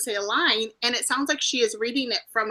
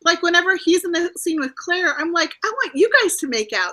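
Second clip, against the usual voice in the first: high, loud and eager gushing; excited gushing; 6 semitones higher, 6 dB louder than usual. Real, not acted. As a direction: gush over the on-screen couple. Gushing about the couple.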